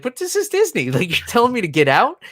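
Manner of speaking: high-pitched naive protest; wide-eyed mock incredulity, ending on a question; innocent disbelief. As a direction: imitate a baffled, innocent guest. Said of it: "it was just so innocent".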